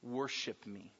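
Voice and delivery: low, hushed temptation with long pauses; quiet, insinuating devil's offer; Satan tempting. Acted. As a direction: tempt softly, dropping low and letting each promise hang. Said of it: "he heard the words of Satan".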